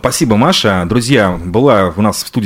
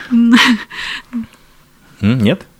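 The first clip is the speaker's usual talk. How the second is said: ordinary talk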